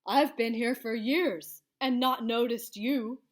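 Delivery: a friendly beast rumble; monster voice. Acted. Blue-toed beast telling child in creature voice. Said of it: "said the beast".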